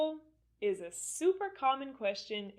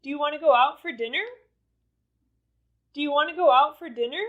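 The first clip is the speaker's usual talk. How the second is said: quick, louder question climbing on "dinner"; rising intonation; 5 semitones higher, 6 dB louder, much faster than usual. Shown as a demonstration. Teacher repeating for learners to say along, rising intonation on "dinner". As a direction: lift "dinner" at the end. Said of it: "with the rising or falling intonation".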